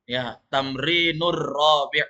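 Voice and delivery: slow, "Tamrinur rabi'" with the r held and doubled into "rabi'"; teacher drills the joined r slowly; linked r. Shown as a demonstration. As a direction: lean on the rolled r of "Tamrinur rabi'". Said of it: "Langsung nur".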